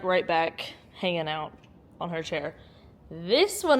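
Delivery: normal talking voice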